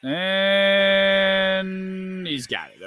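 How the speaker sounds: long, high, slow play call; drawn-out call. Real, not acted. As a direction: stretch it like a broadcaster calling the play. Watching a play, calling it out.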